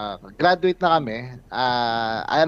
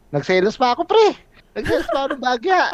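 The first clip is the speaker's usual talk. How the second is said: excited outburst; 10 semitones higher than usual. Retold or imitated. Retelling his own jealous outburst.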